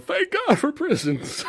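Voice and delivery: high, laughing repeat of the line. Retold or imitated. Retelling Pryor walking around, high and laughing. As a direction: giggle the punchline out.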